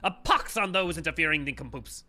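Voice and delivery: elevated, hammy villain monologue; twee villain voice. Acted. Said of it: "the elevated, like, twee villain voice".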